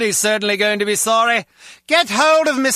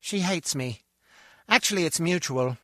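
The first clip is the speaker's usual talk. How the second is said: quiet grumble; 3 semitones lower, 9 dB quieter than usual. Acted. Grumbling about his mother-in-law.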